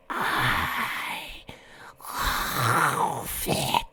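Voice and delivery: whispered, burbling monster voice; soft, voiceless creature burble; underwater creature voice. A narrator's reading. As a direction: breathe the words out slowly as if underwater. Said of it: "as though its origins came from practically underwater".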